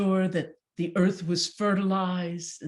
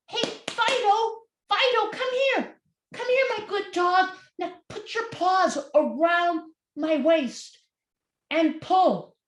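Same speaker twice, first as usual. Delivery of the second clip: much higher coaxing call; calling the dog; 12 semitones higher, 4 dB louder than usual. Acted. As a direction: coax the dog over sweetly.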